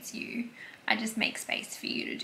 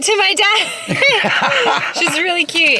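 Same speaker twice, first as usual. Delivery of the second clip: real laughter through the words, louder; laughing; 9 semitones higher, 14 dB louder, much slower than usual. Real, not acted. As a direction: giggle while gushing about her. Laughing after fan meeting.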